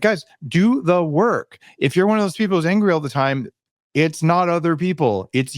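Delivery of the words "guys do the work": slow, high, stretched-out plea to everyone; exasperated plea. Retold or imitated. Pleading with angry people, slow and exasperated. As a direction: spell it out slowly to people who won't listen. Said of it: "I'm like".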